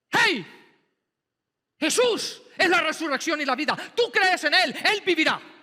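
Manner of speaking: breezy brush-off to a widow; hammed-up careless pastor cheer; tactless cheer. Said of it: "Estaría en el periódico... Abuso pastoral".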